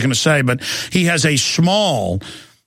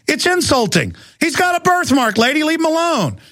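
much higher, sharp indignant rebuke; indignant retort; 11 semitones higher than usual. Retold or imitated. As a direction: defend your son, pitched way up. Dad retells telling off lady.

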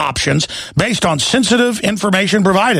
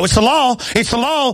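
higher, mocking bureaucrat; mock threatening official; 5 semitones higher than usual. Host mocking census takers who threaten you, higher and snide. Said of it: "they threaten you and go".

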